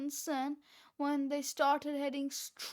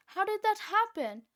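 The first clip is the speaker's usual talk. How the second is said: surprised; 6 semitones higher, 3 dB louder than usual. A narrator's reading. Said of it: "cried Fluffy".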